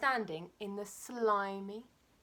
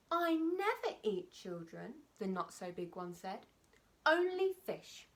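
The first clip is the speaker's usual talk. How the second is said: timid little crocodile; 2 semitones higher, slower than usual. Acted. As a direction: squeak it out as the small, picky croc. Not so big croc's voice.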